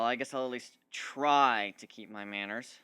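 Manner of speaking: plain reading voice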